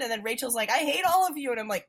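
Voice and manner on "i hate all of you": high, loud retold fury; mock angry outburst. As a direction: imitate a fed-up character lashing out at everyone. Acting out dinner quarrel.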